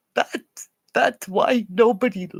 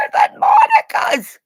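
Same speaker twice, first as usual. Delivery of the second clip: rising shout; 5 dB louder than usual. Real, not acted. Building to a shriek.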